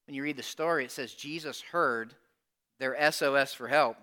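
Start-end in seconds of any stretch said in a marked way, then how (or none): none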